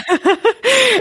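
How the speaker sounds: sudden high laugh; laughing burst. Real, not acted. Guest laughing while retelling the aunt's line, high laugh.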